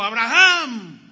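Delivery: loud call from the sky; the angel calling down from heaven, loud; strong call. Acted. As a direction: call his name out loudly, drawn out. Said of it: "Le habló fuerte".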